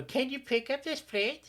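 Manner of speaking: high mocking sing-song copy; mocking echo. Teen mimics her mother's request back at her, mocking. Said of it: "she would go".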